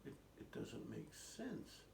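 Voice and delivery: slow, high, soft puzzled protest; doubtful children. Retold or imitated. Siblings answering the old man, doubtful. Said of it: "And they said".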